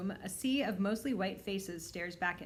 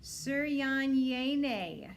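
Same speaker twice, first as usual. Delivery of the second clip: Suryanyene sounded out very slowly, stumbling; a teacher struggling, drawing it out; slow, higher, unsure attempt at the name; teacher fumbling the name; 5 semitones higher, 4 dB louder, much slower than usual. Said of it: "as she attempts to sound it out".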